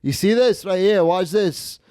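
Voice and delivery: mock-insistent, demanding witnesses; Stefano. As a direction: imitate a gambler playing to the cameras. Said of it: "point to the camera and be like".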